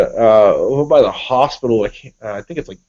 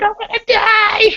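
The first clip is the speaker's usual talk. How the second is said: much higher, slow, drawn-out shriek of imitated terror; mock panicked wife. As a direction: do the wife's hammed-up panic in the rotary. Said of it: "she's crying".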